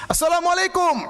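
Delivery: a high, loud deliberate greeting; loud shout. Retold or imitated. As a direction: shout the salam to the statue on purpose. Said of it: "Karena dia teriak keras". (salam diteriakkan keras dan tinggi)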